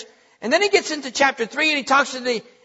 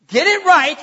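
higher, louder "Get it right"; forceful rebuke; 5 semitones higher, 5 dB louder than usual. Acted. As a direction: drive the command home. Pastor voices God's rebuke.

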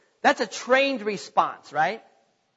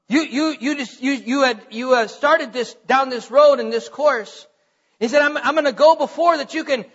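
higher, louder sermon voice driving every phrase home; full-force preaching; 4 semitones higher, 4 dB louder than usual. Acted. Pastor urging the congregation about Paul's promises, full-force preaching. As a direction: preach it, pushing each line to the back pew.

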